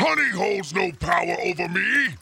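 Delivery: roaring villain defiance; demonic shout. Acted. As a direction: bellow it like a demon lord. Demon persona refuses honey.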